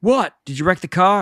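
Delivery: high, loud, startled cartoon dad; Homer's alarmed question. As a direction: demand an answer in mock alarm. Homer reacts to panicking kids.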